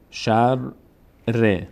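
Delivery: "شر" closed on r, pause, then "ر" with its vowel; doubled letter, split. Shown as a demonstration. Teacher applies the same rule, syllables split by a pause. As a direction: sound out "شر", stop, restart r in "ر".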